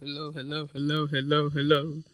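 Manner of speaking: repeated hellos fading away into echo. Pretend. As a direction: call hello and let it echo off into the distance.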